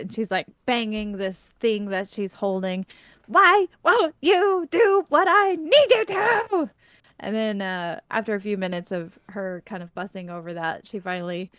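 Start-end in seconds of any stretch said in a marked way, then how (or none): 3.3-6.7 high, loud, drawn-out exasperated yell; cursing in frustration. Acted. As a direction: curse at the stubborn thing, slow and high. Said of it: "then she starts cursing at it".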